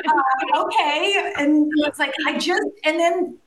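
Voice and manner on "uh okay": higher, bewildered little okay; mock taken aback. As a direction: play the caught-off-guard sister. Sister answering the sudden call.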